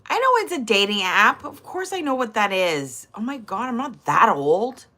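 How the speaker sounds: ordinary talk, nothing marked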